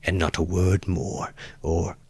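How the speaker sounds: soft, measured warning left hanging; hushed, controlled threat; quiet and distinct. A narrator's reading. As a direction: say it slowly under your voice, very clearly. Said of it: "he said quietly and distinctly".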